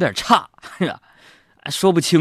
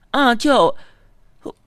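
put-on child voice; 10 semitones higher, 4 dB louder, slower than usual. Niece's punchline answer.

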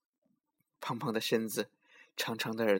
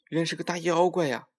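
higher, louder frightened exclamation; alarmed realization; 6 semitones higher, 5 dB louder than usual. A narrator's reading. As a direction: blurt it out in sudden alarm. Tiger realizes it is a monster.

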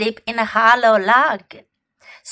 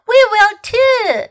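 much higher, chorus of little birds; chirping birds; 11 semitones higher, 7 dB louder than usual. Acted.

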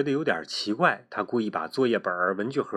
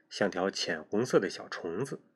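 usual speaking voice

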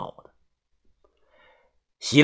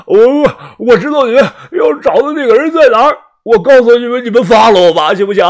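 much higher, louder desperate begging; panicked pleading; 10 semitones higher, 10 dB louder than usual. Acted. Captive begging the narrator to let him go, high and panicked. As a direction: bargain for his life in panic.

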